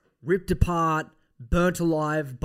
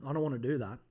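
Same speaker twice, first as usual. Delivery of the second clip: ordinary talk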